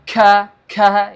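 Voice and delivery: ka, ka spat out with a big puff of air, loud; wrong demo of the kaf, overblown and loud; exaggerated breathy ka. Shown as a demonstration. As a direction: blow too much air on ka, ka. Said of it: "Terlalu banyak nafas keluar di situ".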